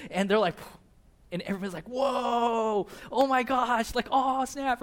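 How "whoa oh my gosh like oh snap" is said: high, drawn-out crowd amazement; hammed-up awestruck exclamations; amazed. Onlookers seeing fire fall from heaven, acted amazement.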